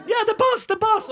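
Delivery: high excited calling; eager shout. Retold or imitated. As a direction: call out eagerly while running. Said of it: "ivrig rumener som kommer løpende". (ivrig rop på engelsk)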